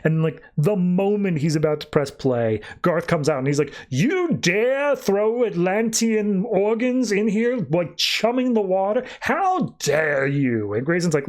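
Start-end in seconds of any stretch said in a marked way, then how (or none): 3.9-10.7 high, slow, drawn-out outrage; hammed-up offended decree; indignant sea warrior. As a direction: imitate an outraged, imperious challenger. Enraged Garth confronts Grayson.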